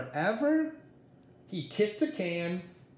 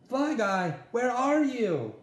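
calling out; 7 semitones higher than usual. Acted. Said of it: "he cried".

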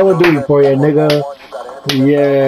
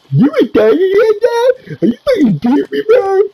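raging disbelief. Real, not acted. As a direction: rage at the screen. Raging at a teammate.

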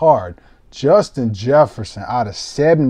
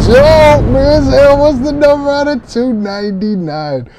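excited shout; 9 semitones higher, 7 dB louder than usual. Real, not acted. Big hit pulled on stream.

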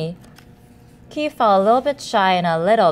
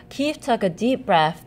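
usual speaking voice

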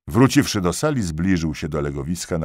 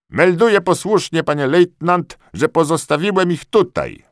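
high, loud servile report; eager corporal; 8 dB louder than usual. A narrator's reading. Corporal reporting to the lieutenant, high and eager.

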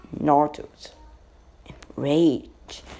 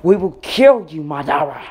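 said out loud; 7 dB louder than usual. A narrator's reading. Naruto and Sasuke shout their threat at Madara. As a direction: yell the threat with everything. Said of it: "They both said out loud".

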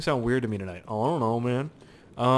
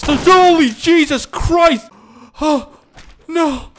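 loud shocked shout; shocked scream; 12 dB louder, much slower than usual. Real, not acted. Gamer reacting to a sudden shock, shouting. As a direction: cry out in horror.

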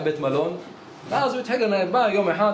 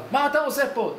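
higher, sharp guard's yell without real volume; mock guard yell; 6 semitones higher than usual. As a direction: bark it like a guard catching an intruder. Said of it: "צועק עליו".

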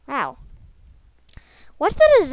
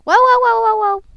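high, loud run of whoas; alarmed whoas; 9 semitones higher, 8 dB louder than usual. Real, not acted. Player reacts to a sudden mishap in the game, alarmed. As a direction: yelp whoa over and over, high and loud.

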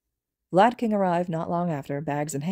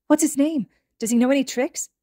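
excited; 8 semitones higher, 4 dB louder than usual. Acted. Toga thrilled by puppy.

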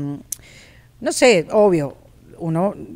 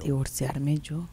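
"Divorciarme yo" slow, much lower and softer, lifting at the end; pondering tone; 7 semitones lower, 9 dB quieter, much slower than usual. Shown as a demonstration. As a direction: echo "Divorciarme yo" slowly, half musing. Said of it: "¿Cavila o pregunta?". ("Divorciarme yo" lento, grave y suave, con leve subida)

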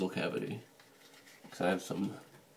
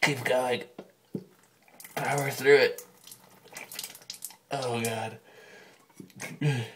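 during a neti pot rinse; 3 semitones higher than usual. Real, not acted. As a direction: coach yourself through a sinus flush. Second side of the rinse.

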